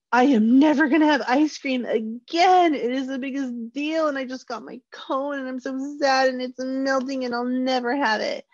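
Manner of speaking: put-on high, dramatic kid despair over a dropped cone; wailing child. A child's inner voice mourning the dropped ice cream cone, high and overdramatic. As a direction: whine it like a heartbroken little kid. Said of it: "my little brain tells me".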